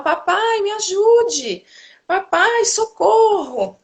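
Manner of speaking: put-on high, slow, drawn-out cries of a small child; child crying for help. Little son calling his father from bed, high drawn-out wails. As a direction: mimic a toddler wailing for his dad. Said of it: "ele grita pro meu marido".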